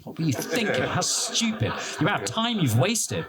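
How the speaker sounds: Russian: насмешливый выговор сквозь смех; laughing mock scolding. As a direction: play the exasperated official while cracking up. Bored civil servant scolding, retold.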